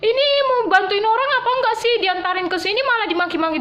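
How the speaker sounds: high, loud indignant cry; retold outburst. Maid venting at the foundation boss, high and loud. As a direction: burst out in frustration. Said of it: "menjerit, berteriak".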